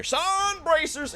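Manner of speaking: stretched, high hype shout; excited reveal. Acted. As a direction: announce it like a prize. Big reveal of number two.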